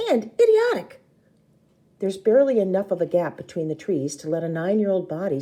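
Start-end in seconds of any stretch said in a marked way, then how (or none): 0.1-1.0 high, louder exasperated jab. A narrator's reading. Narrator exasperated at reckless sister, high jab. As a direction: throw the word out in disbelief.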